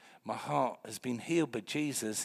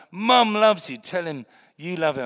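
loud urging; 6 semitones higher, 14 dB louder than usual. Retold or imitated. God's words to the corpse.

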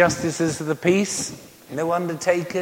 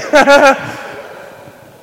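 loud high burst of laughter; real hearty laugh; big laugh; 11 semitones higher, 11 dB louder than usual. Real, not acted. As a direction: crack up laughing. Laughing at own story.

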